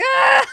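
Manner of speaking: sharp high yelled ah; retold scream. Reliving slamming her purse on the bed, a high angry yell. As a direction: let out a short furious yell. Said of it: "just screaming".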